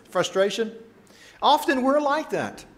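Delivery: ordinary talk, nothing marked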